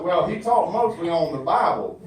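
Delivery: ordinary talk, nothing marked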